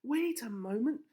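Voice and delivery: high, loud excited call; excited cry. A narrator's reading. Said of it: "Missus Rose cried".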